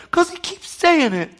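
very high, loud mock protest; hammed-up plaintive retort; acted-out complainer. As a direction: whine back an exaggerated excuse. Same acted complainer answers back.

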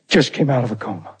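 ordinary delivery

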